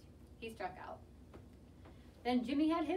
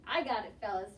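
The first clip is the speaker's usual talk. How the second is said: ordinary delivery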